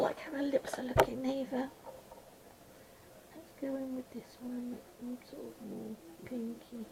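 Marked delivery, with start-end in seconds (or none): 3.4-6.9 soft, mumbled self-talk; murmured thinking aloud while drawing; mumbling. Real, not acted. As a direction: mumble it quietly, as if to yourself. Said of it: "sorry if I'm mumbling".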